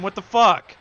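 loud frustrated outburst; angry shout. Real, not acted. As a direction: yell it in disbelief. Gamer raging at boss.

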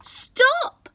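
put-on greedy boy crying at the pot to stop. A narrator's reading. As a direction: yell at the pot in panic.

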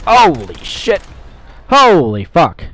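loud, high exclamations; shocked outburst. Real, not acted. Player shocked mid-firefight, loud exclaiming.

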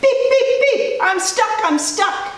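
very high, urgent beeping cries; little truck crying out. A narrator's reading. Little Blue calling the animals, high alarmed cries. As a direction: squeak out a stuck truck's alarm. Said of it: "cried the little blue truck".

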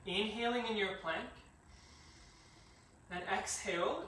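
soft, steady instructor cues over breath; yoga class cueing. Acted. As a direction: guide the class through each pose. Cues a yoga sun salutation.

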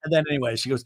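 ordinary delivery

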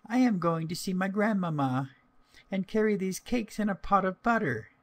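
put-on high little-girl voice; an innocent child's high, lilting voice. A narrator's reading. Little girl telling a wolf about her grandmamma, high and childlike.

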